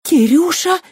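high, loud shocked cry; gasping. A narrator's reading. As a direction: gasp his name. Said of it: "ахнула Кристина". (изумлённо ахнув)

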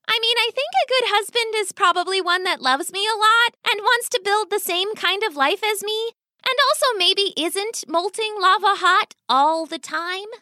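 put-on earnest young rat girl; daughter rat reasoning.

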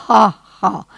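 loud, acted 'ha, ha'; hammed-up burst of party laughter; explosive laugh. A narrator's reading. All the guests greeting a punchline with acted explosive laughter. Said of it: "explosa tout un chacun".

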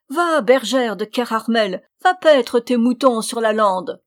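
loud, jeering command; ferocious mockery. A narrator's reading. Witch dismissing the new shepherdess, fiercely mocking. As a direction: send her off with savage scorn, no laugh. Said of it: "en riant d'un rire féroce".

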